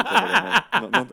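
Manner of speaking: laughing. Real, not acted. Denying while laughing.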